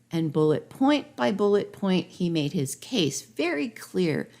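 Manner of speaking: ordinary delivery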